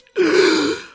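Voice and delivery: sharp high gasp of shock. Acted. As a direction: gasp in alarm. Shock at the belly.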